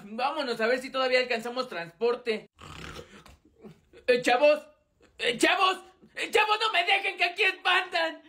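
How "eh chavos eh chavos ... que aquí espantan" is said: scared calling out. Acted. Scared kid calling after friends.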